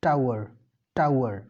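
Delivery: "टावर" said like tower, ow vowel with a clear r; "टावर" with the open American ow; American "tour". Shown as a demonstration. Teacher contrasts the American pronunciation of tour. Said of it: "American English में टावर".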